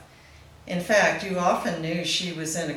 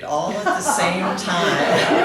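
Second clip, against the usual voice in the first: laughing; 3 semitones higher, 8 dB louder, slower than usual. Real, not acted. Laughing at memory of friend.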